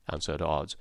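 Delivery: usual speaking voice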